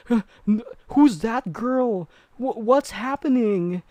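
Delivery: high, breathy-voiced dazed wife; confused ghost wife. Man recounting a horror film voices the dead wife, high and dazed. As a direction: play the dead wife waking, lost and high.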